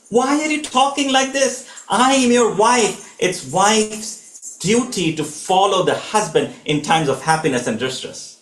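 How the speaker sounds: a wife refusing to be left behind; enraged Sita. Retold or imitated. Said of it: "Now this enrages Sita".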